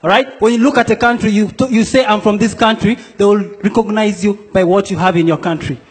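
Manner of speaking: ordinary talk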